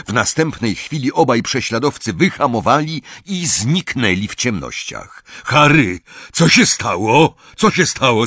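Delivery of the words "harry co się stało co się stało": high, loud, urgent questioning; alarmed raised-voice call. A narrator's reading. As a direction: shout the question over the engine. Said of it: "zawołał Hagrid".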